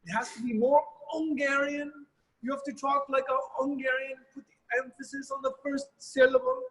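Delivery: high, pushy voice of a conductor correcting a player in front of the orchestra; imitating the conductor. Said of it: "And he'd go".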